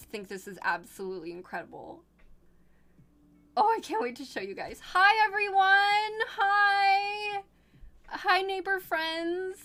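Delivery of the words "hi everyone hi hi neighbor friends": high, loud, drawn-out hellos; cooing greeting. Real, not acted. Streamer greeting neighbor friends in the picture, cooing. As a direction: coo hello with overflowing delight.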